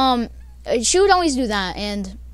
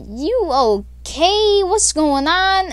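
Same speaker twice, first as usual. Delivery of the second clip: drawn-out, slow, a bit higher; slow concerned ask; 6 semitones higher, 3 dB louder, much slower than usual. Retold or imitated. Friends ask the screaming girl on the call: slow wary concern. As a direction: ask it slowly and warily. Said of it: "we're like".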